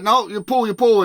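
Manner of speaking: put-on Louis urging the kid to keep pulling; imitating Louis insisting. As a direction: push the kid to pull. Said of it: "he's like".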